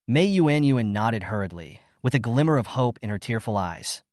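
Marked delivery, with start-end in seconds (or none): none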